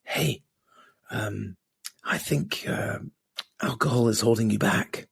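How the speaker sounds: soft, breathy inner whisper. Retold or imitated. Guest voicing the whisper in his head about alcohol, soft. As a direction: coax it like a nagging inner voice. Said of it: "little whispering voice that goes".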